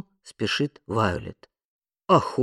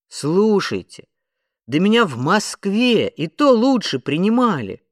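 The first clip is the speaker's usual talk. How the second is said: high, loud indignant complaint; outraged raised voice; 7 semitones higher, 5 dB louder than usual. A narrator's reading. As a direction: protest hotly with swooping stresses. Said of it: "возмутился Том Дикс".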